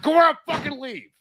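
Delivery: telling his friends to leave at once, loud and high; urgent mock order. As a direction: bark the order to get out now. Urging friends to leave the bar.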